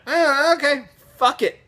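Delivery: high-pitched offhand shrug; mock dismissive. Teller's dismissive reaction re-enacted.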